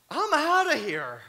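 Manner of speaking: high, loud, slow "I'm outta here"; exasperated exit. Retold or imitated. Preacher retelling escape from a yelling family, loud and high. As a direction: throw it out as you bolt. Fleeing cussing neighbors.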